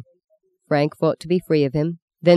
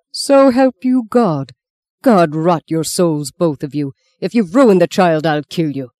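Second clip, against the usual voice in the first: furious roar; 5 dB louder than usual. A narrator's reading. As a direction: thunder a curse at them. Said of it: "the priest roared".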